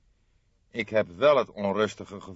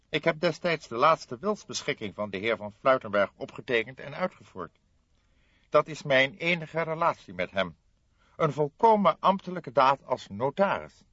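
lightly voiced puzzled notary, higher; a formal notary, a touch higher; 8 semitones higher, 6 dB louder than usual. Notary telling the detectives he is baffled, lightly voiced.

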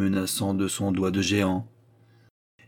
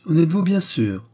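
teasing question; 9 semitones higher, 4 dB louder than usual. Acted. Raymonde teasing Antoine.

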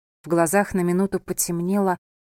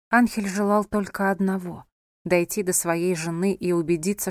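ordinary delivery, nothing marked

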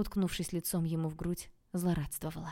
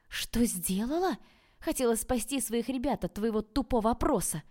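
shouted out, higher and louder; outburst; 6 semitones higher, 4 dB louder than usual. A narrator's reading. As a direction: hurl the words back at him. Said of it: "выкрикнула я". (выкрикивая, выше и громче)

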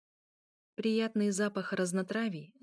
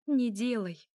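ordinary delivery

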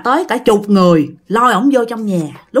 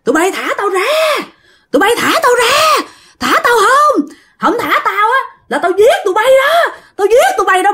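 high-pitched wailing shouts, threatening; possessed man cursing; 12 semitones higher than usual. Acted. Possessed man to his captors, shrieking curses. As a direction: shriek threats while demanding release. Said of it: "Bắt đầu chửi nha".

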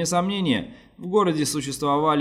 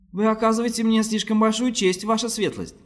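much higher, pleading and hopeful; vain hope; 10 semitones higher than usual. A narrator's reading. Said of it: "сказал он с тщетной надеждой".

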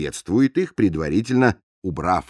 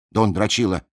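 ordinary talk, nothing marked

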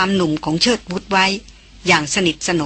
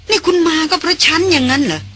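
much higher, amused and surprised question; amused surprise; 9 semitones higher than usual. A narrator's reading. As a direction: ask it half astonished, half laughing. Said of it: "สีหน้าประหลาดใจ ระคนขันขัน".

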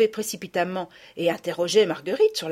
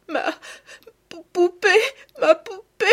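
voix aiguë et forte, hachée de sanglots; sanglots d'enfant; 11 semitones higher, 5 dB louder than usual. Acted. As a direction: une petite fille qui pleure sa poupée. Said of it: "sanglotant et criant". (sanglots d'enfant aigus et forts)

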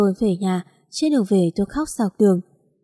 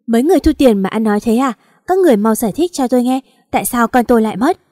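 louder furious shout; angry shout; 3 semitones higher, 5 dB louder than usual. Acted. Husband shouting at clinic staff over lost baby, furious. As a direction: shout them down in fury. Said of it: "Anh quát um lên".

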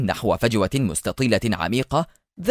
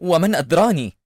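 high, edgy retort; rattled cry, acted without much volume; nervous shout; 6 semitones higher than usual. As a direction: protest nervously with raised pitch. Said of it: "بدا جولهي عصبيا وهو يصرخ".

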